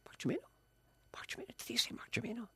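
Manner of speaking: high, hushed disbelieving whisper; stunned whisper. Retold or imitated. Watching the TV show, hushed excited disbelief whispered to himself. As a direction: gasp the name out in hushed disbelief. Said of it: "And I went".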